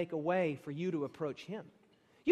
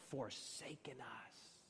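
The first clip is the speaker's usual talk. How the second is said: hushed whisper; 7 semitones lower, 18 dB quieter, slower than usual. Acted. Preacher whispering the verse's grim ending to the congregation. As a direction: breathe the words out in a stark whisper.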